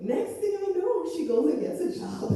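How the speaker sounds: ordinary talk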